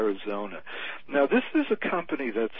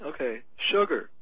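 ordinary talk, nothing marked

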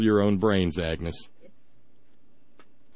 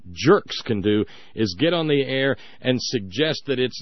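normal talking voice

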